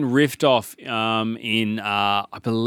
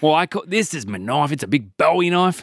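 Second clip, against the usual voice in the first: hammed-up Aussie outback voice showing off; proud Aussie bushman; 6 semitones higher than usual. Bushman shows the cop his knife, proud Aussie accent. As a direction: brag about the blade in broad Aussie. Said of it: "the accent is, uh, throwing me off".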